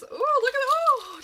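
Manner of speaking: high, loud delighted squeal; excited squeal. Real, not acted. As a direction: gasp with delight at a find.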